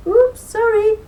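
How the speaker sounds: high, loud flustered oops; blustering apology. Acted. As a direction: fumble the sorry in a fluster. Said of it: "blustered Niall".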